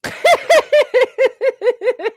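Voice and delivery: high mocking laugh; loud laugh. Real, not acted. Host mocking the guest, loud laugh. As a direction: laugh at her in disbelief.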